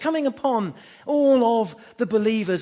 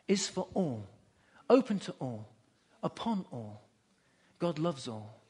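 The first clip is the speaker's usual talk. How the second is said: low, soft preaching with long pauses; quiet, lowered voice, savouring each phrase; hushed awe; 8 semitones lower, 9 dB quieter than usual. Real, not acted. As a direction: let each phrase drop into a hush. Preacher drops to hushed climax.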